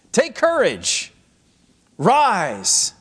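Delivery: high, slow rallying call; cheering him on. Retold or imitated. Crowd urging the blind beggar to get up, heartening. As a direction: urge him up, pitched high and stretched out. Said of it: "Bartimaeus hears a different voice".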